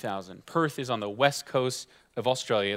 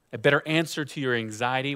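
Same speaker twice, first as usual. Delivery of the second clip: ordinary talk, nothing marked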